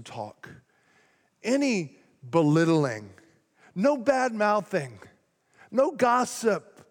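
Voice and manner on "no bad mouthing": ordinary talk, nothing marked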